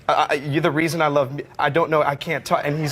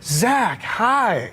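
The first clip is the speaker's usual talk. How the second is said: [name] impression; 8 semitones higher, slower than usual. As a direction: lift the pitch and keep it gentle and breathy-free, like Michael. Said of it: "And he goes".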